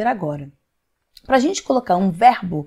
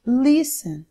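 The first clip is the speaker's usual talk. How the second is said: LIS-ten, weight on the opening LIS; stress on first syllable; 3 semitones higher than usual. Shown as a demonstration. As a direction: lean on LIS, let the ten drop away. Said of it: "Mais forte".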